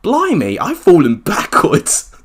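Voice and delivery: high, loud disbelief; surprised. Real, not acted. Shocked at own short intro.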